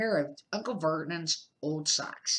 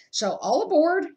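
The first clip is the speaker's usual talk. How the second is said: louder, higher pleased uncle; hearty Uncle Vernon; 9 semitones higher, 7 dB louder than usual. Acted. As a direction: crow about the supplies.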